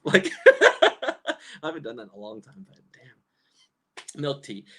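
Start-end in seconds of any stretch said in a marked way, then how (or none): 0.4-1.6 loud high cackle; laughing. Real, not acted. Laughing at her Taco Bell story, loud high cackle.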